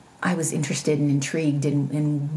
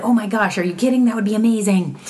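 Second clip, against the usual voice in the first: excited; 6 semitones higher, 5 dB louder, faster than usual. Retold or imitated. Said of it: "And I said".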